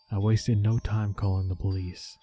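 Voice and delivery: ordinary delivery, nothing marked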